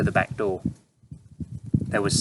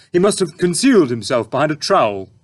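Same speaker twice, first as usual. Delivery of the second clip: protesting; 7 semitones higher, 8 dB louder than usual. A narrator's reading. Dad still protesting.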